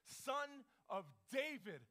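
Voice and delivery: slow, emphatic weight on "Son of David"; stress on changed title. Retold or imitated. Preacher showing the title Bartimaeus changed, "Son of David" slow and high. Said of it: "he does something different. He adds".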